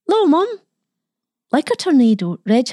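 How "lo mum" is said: put-on high-pitched boyish call; excited boy. A narrator's reading. Boy rushes in to greet his mum, excited. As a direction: burst out with an eager, high greeting.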